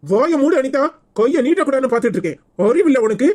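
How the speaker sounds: heated self-defence; angry rebuke. Acted. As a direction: shut down the accusation sharply. Rebuking an accusation in a quarrel.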